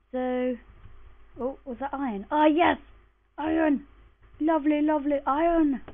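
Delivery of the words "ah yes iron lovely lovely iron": excited. Real, not acted. Finding iron while mining.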